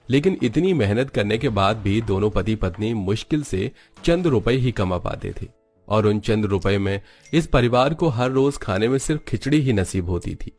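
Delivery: usual speaking voice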